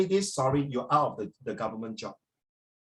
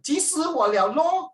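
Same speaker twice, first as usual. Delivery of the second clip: high-pitched Malaysian Chinese mimicry; Malaysian Mandarin accent; 11 semitones higher, 4 dB louder, slower than usual. Overheard Malaysian fretting, high Malaysian Mandarin accent. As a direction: exaggerate the Malaysian Mandarin lilt. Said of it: "Eh, Malaysian".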